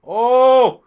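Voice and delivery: long, high shouted "oh!"; real excited holler at the game; loud "oh!". Real, not acted. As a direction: belt out a big drawn-out "oh!". Reacting to the game.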